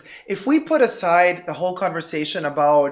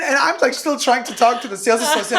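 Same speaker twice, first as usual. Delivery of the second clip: ordinary talk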